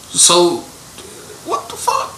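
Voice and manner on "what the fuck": high unvoiced gasp of disbelief; stunned, airy whisper of disbelief; disbelieving what the fuck. Real, not acted. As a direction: breathe it out high in shock. Disbelief at the claim.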